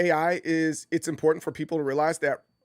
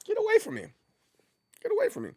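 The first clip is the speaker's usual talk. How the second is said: much higher, recoiling get away; recoiling stranger; much faster than usual. Retold or imitated. Stranger pushing off a pushy guy, high recoil. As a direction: shriek it off like a creeped-out stranger. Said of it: "You're like".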